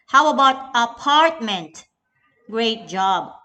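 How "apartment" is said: apartment with PART punched louder; apartment, loud on the middle syllable; stress on second syllable. Shown as a demonstration. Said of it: "Part is the stress syllable".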